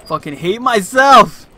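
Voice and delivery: loud frightened yelling; scared shouting. Real, not acted. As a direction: yell out after a jump scare. Jump scare in horror game.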